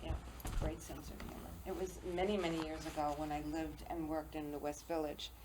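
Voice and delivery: ordinary talk, nothing marked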